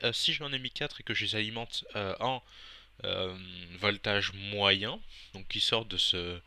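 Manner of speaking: normal talking voice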